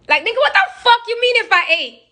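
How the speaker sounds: baffled that he asks if she ate; incredulous retort. Retold or imitated. Sister scoffing at her brother's odd text, high incredulous.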